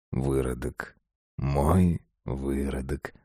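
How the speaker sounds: hushed, low, drawn-out murmur; whimpering whisper. A narrator's reading. Father whispers to Masha, whimpering with arousal. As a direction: breathe it slowly and low, creepily intimate. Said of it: "шепнул он, поскуливая от возбуждения".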